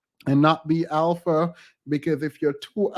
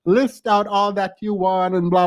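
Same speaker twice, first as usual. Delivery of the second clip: ordinary talk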